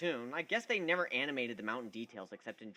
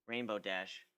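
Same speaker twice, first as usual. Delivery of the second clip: usual speaking voice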